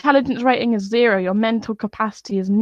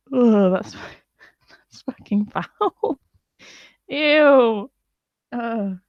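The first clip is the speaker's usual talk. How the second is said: laughing in disgust, slow; a grossed-out laugh; 2 semitones higher, much slower than usual. Real, not acted.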